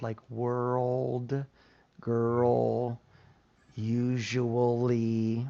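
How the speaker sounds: put-on world, girl, usually drawn out slowly; demo sounds. Shown as a demonstration. Teacher modeling "usually" for Jessica, slowly. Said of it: "We would work on your all, all girl sound".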